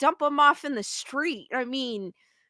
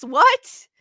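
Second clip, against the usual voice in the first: louder rising what; disbelieving what; 4 semitones higher, 7 dB louder than usual. Real, not acted. Streamer reacting to the legal news, rising what. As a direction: still can't take it in.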